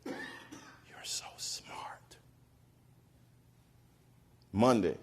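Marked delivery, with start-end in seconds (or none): 0.0-2.2 soft breathy whisper, slow. Retold or imitated. Teacher whispering praise to her student, slowly. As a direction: whisper it into a child's ear. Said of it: "and whisper in my ear".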